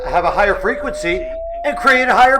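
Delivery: rising pitch. Shown as a demonstration. Voice climbs to illustrate higher pitch.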